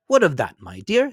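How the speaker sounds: lightly voiced jolly young man; cheerful nephew. A narrator's reading. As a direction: speak up kindly for the old man.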